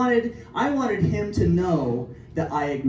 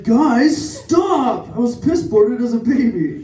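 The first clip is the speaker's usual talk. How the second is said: loud, higher-pitched mock plea to stop; mock protest; 3 semitones higher, 4 dB louder, slower than usual. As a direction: cry out in pretend protest at teasing friends. Mock protest to teasing friends.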